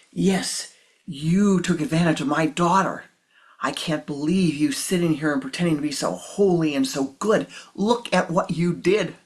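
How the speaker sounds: loud outraged accusation; furious father shouting; angry shout. Acted. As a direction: tear into the monk. Grandfather shouting at monk.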